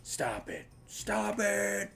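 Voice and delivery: first held back, then high and drawn out; mock desperate scream. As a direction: imitate a desperate movie scream, building. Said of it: "screaming into the phone".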